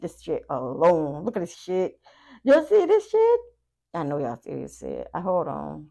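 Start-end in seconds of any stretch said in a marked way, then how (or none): 2.4-3.4 high, loud, gleeful squeal; excited squeal. Real, not acted. Squealing to viewers over the cards. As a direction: shriek it with delight.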